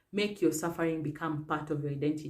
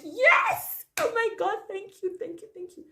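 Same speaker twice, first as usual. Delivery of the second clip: relieved joy; 5 dB louder than usual. Retold or imitated. Woman finding her trousers untorn after a fall, thrilled relief. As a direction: shout with joy, then breathe out the thank yous.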